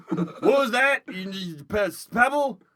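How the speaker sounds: barked orders, half laughing; drill sergeant. Acted. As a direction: bark it like a boot-camp sergeant.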